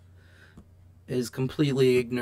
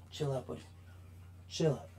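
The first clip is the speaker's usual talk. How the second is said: quiet self-calming; 15 dB quieter, faster than usual. Real, not acted. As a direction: talk himself down gently, almost under the breath. Calming himself down after ranting.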